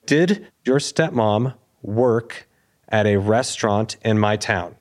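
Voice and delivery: very slow, halting question in chunks; stunned, halting. Retold or imitated. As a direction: act out struggling to get the question out. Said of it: "stuttering at this point".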